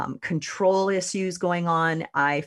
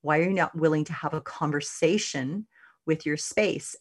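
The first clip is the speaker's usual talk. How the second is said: normal talking voice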